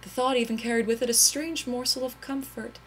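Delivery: ordinary delivery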